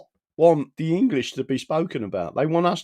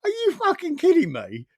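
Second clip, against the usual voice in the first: incredulous. Retold or imitated. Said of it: "I was like".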